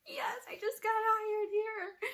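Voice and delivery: high, slow tearful wail; mock crying. Crying patient admitting new job to nurse, drawn-out mock wail. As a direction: imitate sobbing out a confession. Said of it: "just crying and crying and crying".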